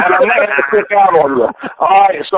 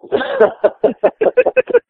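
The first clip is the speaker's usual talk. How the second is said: laugh; 3 dB quieter than usual. Real, not acted. Laughing at his own joke.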